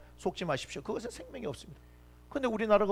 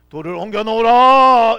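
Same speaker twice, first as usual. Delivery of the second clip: much louder, slow order; solemn command; 3 semitones higher, 14 dB louder, slower than usual. Acted. Jesus ordering the stone moved at Lazarus' tomb, loud slow command. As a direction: command it with authority.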